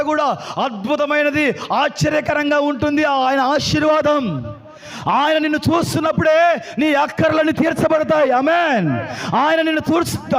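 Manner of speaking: full-voiced proclamation with ringing amens; fervent preaching. Real, not acted. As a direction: rouse the congregation with each phrase. Preacher declares blessing. (ఉత్సాహంగా, గట్టిగా ప్రకటిస్తూ)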